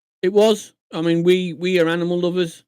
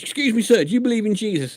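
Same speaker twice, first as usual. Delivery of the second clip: high eager preacher voice, lightly done; mock street preacher; 8 semitones higher than usual. Street preacher calls out to a passerby, high and eager. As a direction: imitate a keen street preacher stopping a stranger. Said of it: "And he goes".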